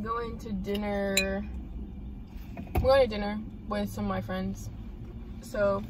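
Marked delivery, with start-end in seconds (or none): none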